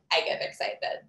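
ordinary talk

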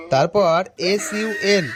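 ordinary talk

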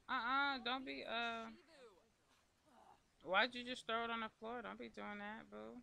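sharp, high, loud telling-off; shocked scolding of a messy Sim. Acted. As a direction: scold her like a misbehaving kid. Scolding her Sim for littering.

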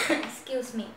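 ordinary talk, nothing marked

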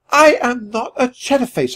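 high, emphatic objection; indignant protest. A narrator's reading. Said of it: "I protested".

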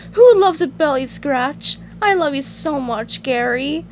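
put-on high pet-owner baby talk; SpongeBob doting. Scratching his snail's belly, high doting coo. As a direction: coo it, starting high and easing down.

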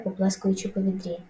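plain reading voice

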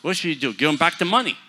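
loud, high retort; loud mock exchange. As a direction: belt the answer to the crowd.

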